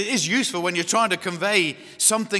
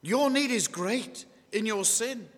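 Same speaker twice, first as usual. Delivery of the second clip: raised, slow, weighty preacher's delivery; forceful preaching; 6 semitones higher, 6 dB louder, slower than usual. Real, not acted. Preacher urging listeners that they need Him, forceful preaching. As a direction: press each phrase home with slow force.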